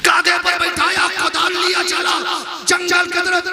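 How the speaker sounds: high-pitched heated storytelling; rising fury. Acted. Father enraged at wife's confession, high heated telling. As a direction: let the anger flare as he storms off.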